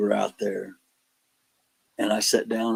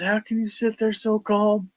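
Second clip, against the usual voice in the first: alarmed question; 11 semitones higher than usual. Retold or imitated. Frightened guys questioning him.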